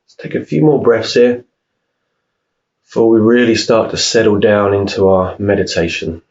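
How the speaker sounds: unhurried meditation voice, long pauses between phrases; guided meditation. Real, not acted. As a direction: calmly guide them through each breath, leaving room to breathe. Leads a breathing meditation.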